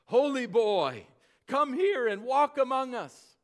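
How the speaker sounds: pleading call from far-off Irish voices. Retold or imitated. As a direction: call him across the sea. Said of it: "Irish voices calling him".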